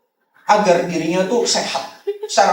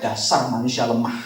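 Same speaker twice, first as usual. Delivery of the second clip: contemptuous quote; 10 semitones lower, 6 dB quieter than usual. Retold or imitated. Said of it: "ngomong gitu".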